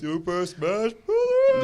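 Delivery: high, drawn-out announcer cry; hyped title call. Pretend. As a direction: belt out the game title in a stretched high voice. Bursts out with game title, announcer style.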